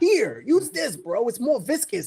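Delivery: high-pitched, exasperated imitation; mock indignant outburst. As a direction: act out a tattoo artist fed up with bad advice. Imagined artist's suppressed angry outburst.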